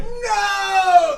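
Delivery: high, loud terrified scream; children screaming at the monster's name. Acted. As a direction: scream in terror. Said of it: "they're like".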